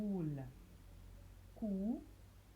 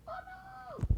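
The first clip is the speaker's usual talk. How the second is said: squeaky high pretend pupil voice; mock child. A teacher voicing a pupil, high mock child protest. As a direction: play a child crying out no.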